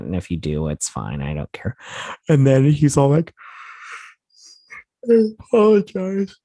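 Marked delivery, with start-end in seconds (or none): 2.3-6.3 talking through a real yawn; high, loud words stretched by a yawn. Real, not acted. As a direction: let a yawn take over the words. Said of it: "I keep yawning".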